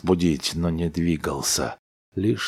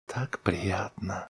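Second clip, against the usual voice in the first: sleepy murmur; 3 semitones higher, 9 dB quieter, slower than usual. A narrator's reading. Wife talks in her sleep.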